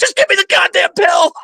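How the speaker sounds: hammed-up demand for the pill; father-in-law yelling at his wife for a painkiller; mock desperate shout. Father-in-law begging for pills.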